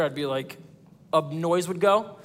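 usual speaking voice

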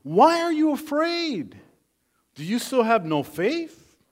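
high, loud, drawn-out question; Jesus challenging disciples. Acted. Jesus questioning his frightened disciples, challenging. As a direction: press the question hard, as a preacher voicing a rebuke. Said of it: "he said to the disciples".

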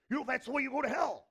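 loud, high-pitched condemnation; condemning shout. Acted. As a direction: shout judgment down at them. Acted condemnation by the judging person.

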